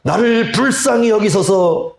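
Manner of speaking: high, slow pleading call; a blind beggar calling out to Jesus, pleading high and slow; pleading cry. Shown as a demonstration. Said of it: "이게 소리를 쳤을 거예요".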